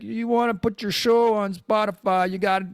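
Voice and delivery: hint of a silly friend voice; lightly voiced Frank. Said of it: "I know you don't talk like this".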